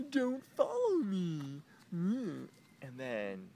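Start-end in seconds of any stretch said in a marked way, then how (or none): 0.1-1.6 mimicking her. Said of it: "uh, she's like".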